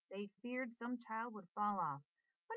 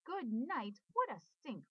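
loud, higher disgusted scream; 4 semitones higher, 4 dB louder, slower than usual. A narrator's reading. Boy screams at the rotten egg smell, loud. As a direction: yell it at the stink. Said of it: "screamed Avery".

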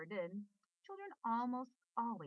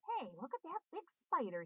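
excited; 7 semitones higher than usual. A narrator's reading. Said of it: "he said".

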